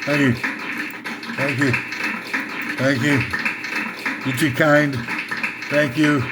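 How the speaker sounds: low, repeated showman thank-yous; a hint of a crooner bowing out; lounge singer thanks. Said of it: "That's my [name] imitation".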